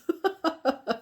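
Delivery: a high, giggly burst of laughter. Real, not acted. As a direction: laugh at your own story. Laughs at scaring the kids.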